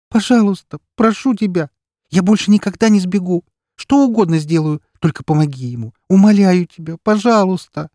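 высоко и громко, на грани слёз; умоляй изо всех сил; отчаянная мольба. Acted. Елена умоляет деда спасти брата. (отчаянно умоляет деда, на грани срыва)

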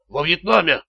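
strained, croaking answer from a hurt man; hoarse croak. A narrator's reading. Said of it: "прохрипел Раттер". (хрипло, с трудом)

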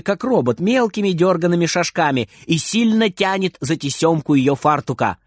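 ordinary delivery

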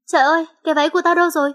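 high, very fast mild yell. A narrator's reading. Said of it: "Dì hét lên".

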